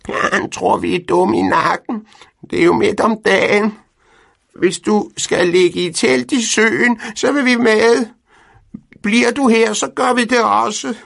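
very high, loud stubborn hen voice; defiant hens. A narrator's reading. Hens defying Pedersen about the tent trip, high and loud. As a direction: refuse like a stubborn flock.

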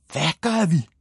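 jumps up high in disbelief; a girl thrown by the idea; high surprised shout; startled cry. A narrator's reading. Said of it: "råbte Mia".

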